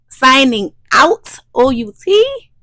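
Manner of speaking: sing-song it like a radio outro; playful sign-off. Real, not acted. Midwife vlogger signing off her channel, playful and loud.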